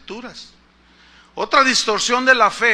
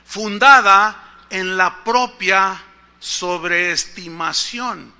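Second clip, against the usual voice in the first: slow read definition; 5 dB louder, much slower than usual. Shown as a demonstration. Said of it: "dice la definición".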